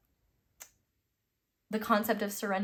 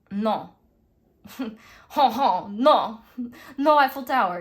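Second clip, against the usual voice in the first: loud, laughing, hammed-up French accent scoffing no; mock French accent; 4 semitones higher, 11 dB louder than usual. French crowd scoffing at the new tower, loud mock French accent. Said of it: "that's my perfect French accent".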